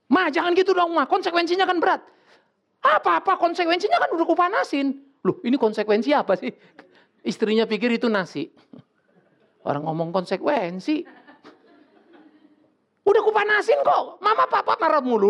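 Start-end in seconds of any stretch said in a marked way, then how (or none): 0.1-2.0 a husband begging Mama to stop; pleading husband. Retold or imitated. 2.8-5.0 a wife sure she already heated it; confused wife. Retold or imitated. Wife mishears, answers. 13.0-15.3 high, loud indignant shout; shouting back. Retold or imitated. Wife shouting back at husband.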